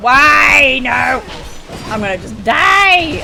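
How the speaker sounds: high, loud gamer outburst; frustrated yell. Real, not acted. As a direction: yell it out at the game. Character trapped in game.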